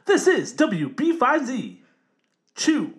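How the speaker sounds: high, loud, slow mock DJ; radio voice. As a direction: belt out a hammed-up station ID. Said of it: "Radio voice".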